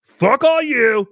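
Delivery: high, loud, drawn-out mock shout; hammed-up slow yell; imitating Chad's outburst. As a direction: holler it in a put-on voice. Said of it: "He was like".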